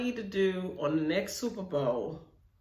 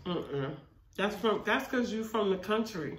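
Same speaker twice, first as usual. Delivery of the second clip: food near the mouth; talking between bites of food; eating while talking. Real, not acted. Seen 1: Left speaker holds mac and cheese cup and loaded fork at mouth while talking.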